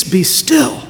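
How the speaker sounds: high, sharp command to the storm; a high, stern voice of Jesus rebuking the wind; stern rebuke. Acted.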